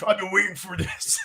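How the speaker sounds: lightly voiced wrestler impression through laughter; a hint of hammy wrestler; Macho Man. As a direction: slip into a mock wrestler voice. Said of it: "I just went Macho Man".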